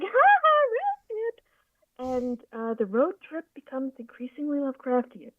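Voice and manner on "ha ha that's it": very high-pitched mock laughing taunt; jeering friends. Friends laughing at his fake Frogman photo, imitated high jeering. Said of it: "his friends are like".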